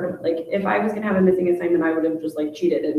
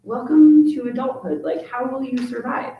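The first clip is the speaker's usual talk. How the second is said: sarcastic retort; 5 semitones higher, 3 dB louder than usual. Retold or imitated. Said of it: "I wanna just be like".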